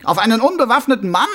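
high, loud mocking voice; a smug killer playing innocent; sneering villain. A narrator's reading. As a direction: Carcer mocking the watchman. Karzer taunts Mumm.

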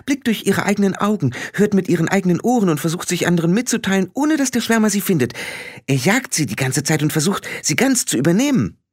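high, eager explaining voice; earnest little fairy man. Acted. Billy explains to Frau Grad how minds work, earnest. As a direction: explain earnestly, pitched well up.

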